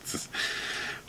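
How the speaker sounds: very soft, breath-only laugh; real laugh, nearly silent; helpless chuckle. Real, not acted. Reseller still tickled by the cigarette ad, hushed laughing. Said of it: "I can't stop laughing".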